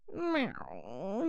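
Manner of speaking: usual speaking voice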